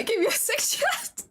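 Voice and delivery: overwhelmed, half laughing half crying; squeaky emotional disbelief; tearful laughter. Real, not acted. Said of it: "I'm trying not to cry".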